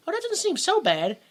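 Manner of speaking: high, airy mock agreement; mock naive agreement. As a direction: play it falsely open-minded, way up high. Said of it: "you go".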